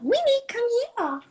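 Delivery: high sing-song pet call; very high, cooing summons. Real, not acted. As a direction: coax the dog over in falsetto. Calling the dog over.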